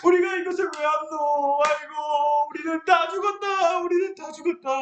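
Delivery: high, crying "아이고" laments; whole nation weeping through the night; wailing all night. Acted. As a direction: sob and wail as if doomed. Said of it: "밤새도록 울었어요".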